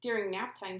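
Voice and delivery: usual speaking voice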